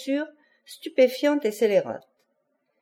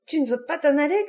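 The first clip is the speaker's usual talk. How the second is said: crying out; 6 semitones higher, faster than usual. A narrator's reading. Conchita sees he will not leave, cries out in fury. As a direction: raise the pitch in disbelief, voice held back. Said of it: "cria-t-elle".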